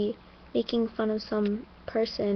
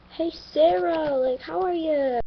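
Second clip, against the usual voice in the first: much higher, louder, slow drawn-out hello; excited greeting; 7 semitones higher, 4 dB louder than usual. Retold or imitated. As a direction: call out to a friend you're thrilled to see. Greeting seventh grade friend warmly.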